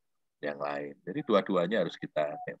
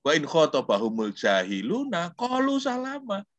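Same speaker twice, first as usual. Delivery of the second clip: quran recitation; 8 semitones higher than usual. Shown as a demonstration. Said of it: "Yang ada itu apa?".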